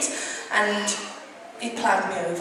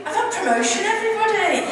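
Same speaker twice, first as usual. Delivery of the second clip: bright, raised announcement to the room; excited announcement; 9 semitones higher than usual. Real, not acted. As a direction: share the big news. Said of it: "you can do better than that".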